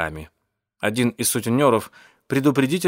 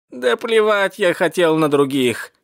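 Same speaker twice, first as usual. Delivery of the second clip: higher, slow dismissive grumble; grumbling; 7 semitones higher, 4 dB louder than usual. Acted. Cat tells off those above, grumbling. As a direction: grumble it out slowly, pitched up. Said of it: "проворчал кот".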